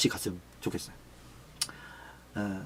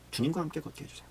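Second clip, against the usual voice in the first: much softer hushed repeat of 주님과 함께 걷게 해주세요; quiet prayer; 2 semitones higher, 11 dB quieter than usual. Shown as a demonstration. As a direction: breathe the prayer almost under the breath. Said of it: "repeated softly". (아주 작게 속삭이듯 "주님과 함께 걷게 해주세요")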